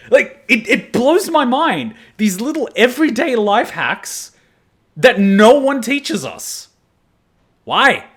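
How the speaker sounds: high, loud exasperated outburst, slowing to stress each word. Real, not acted. Ranting that schools never teach everyday life hacks, exasperated and loud. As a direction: demand answers from the school system.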